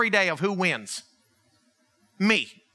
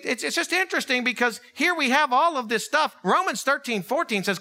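usual speaking voice

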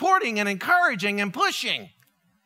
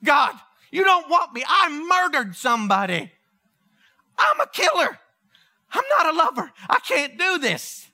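pleading protest; 4 semitones higher than usual. Acted. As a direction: cry out as a panicked Moses begging off. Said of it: "I would pull out the murder card".

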